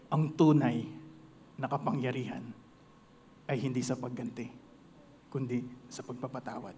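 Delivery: low, soft solemn voice; wise lion. Acted. Lion softly teaching the mouse about forgiveness. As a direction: speak like an elder giving a moral.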